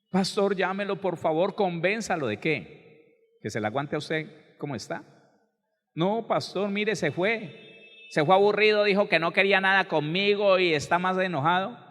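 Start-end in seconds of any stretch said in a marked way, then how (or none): 0.1-2.3 higher-pitched mock begging; imitated pleading. Wife begging the pastor to call her husband, imitated pleading. 5.9-11.8 high, louder mock-distraught report; imitated distress. Wife telling the pastor her husband left, imitated distress.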